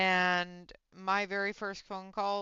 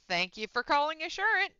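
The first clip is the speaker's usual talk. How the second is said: louder, higher drilled call-center opener; rehearsed phone greeting; 7 semitones higher, 5 dB louder than usual. Retold or imitated. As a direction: drill the correct greeting aloud. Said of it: "constantly repeating the, the entrance of".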